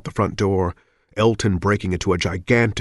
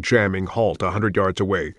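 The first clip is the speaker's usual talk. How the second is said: usual speaking voice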